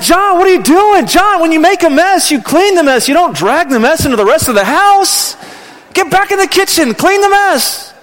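high, loud exasperated scolding; angry parental yelling. Retold or imitated. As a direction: yell at a child who made a mess. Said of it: "there was a little yelling".

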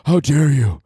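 high huffy superfan, mock outrage.

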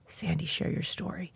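soft unvoiced whisper of a calling voice; whispered inner voice. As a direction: breathe it like a voice in her head. Inner voice quoted again.

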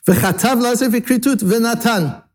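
high, even run ending on "v'natan", then a stop; phrase closed after v'natan. Shown as a demonstration. Teacher showing where the comma goes, high phrase stopping after "v'natan". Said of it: "you can put the comma after the v'natan".

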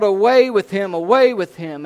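loud, insistent yell. A narrator's reading. Crowd rejecting the prisoner before Pilate, yelling. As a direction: yell it twice, easing slightly on the repeat. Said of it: "they yelled".

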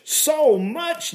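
high-pitched cry of surprise; amazed exclamation. A narrator's reading. Naomi sees the gleaned barley, exclaiming in amazement. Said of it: "Naomi exclaimed".

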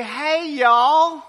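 loud, high, stretched-out Texas greeting; Texas accent. Retold or imitated. Speaker imitates how everyone in Texas says hello, big Texas accent. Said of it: "in Texas that everyone would say".

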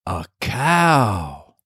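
put-on small boy repeating in wonder; little boy. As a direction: say it like a curious little kid. Little boy repeats after dad.

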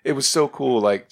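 normal talking voice

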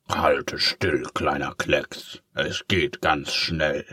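slow, hushed villain whisper; slow creepy whisper; menacing whisper. Acted. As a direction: whisper it as you pounce. Villain closing in.

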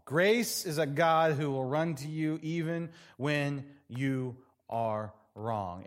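slowed right down, leaning on each word; slow emphatic refrain. Shown as a demonstration. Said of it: "repeated refrain, slowed".

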